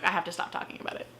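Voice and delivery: fast, breathless aside; muttered to herself. Real, not acted. Reviewer cuts her own rant short, muttering to herself. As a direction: rush it under your breath.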